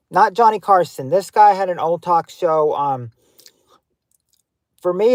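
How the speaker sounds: usual speaking voice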